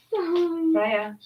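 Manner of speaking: calling out off-mic, high; turning away from phone. Real, not acted. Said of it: "Sorry, that was my daughter".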